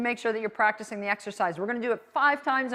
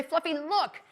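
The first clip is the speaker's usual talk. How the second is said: calling the dog; 5 semitones higher, 4 dB quieter, slower than usual. Shown as a demonstration. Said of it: "you say".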